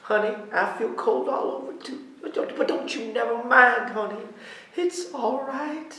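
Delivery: soothing mother. Acted.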